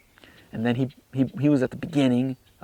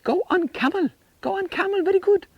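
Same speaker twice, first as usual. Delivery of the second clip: much higher, eager Egyptian guide in broken English. Retold or imitated. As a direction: pitch a street tout's broken Egyptian English way up high. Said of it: "And then he said".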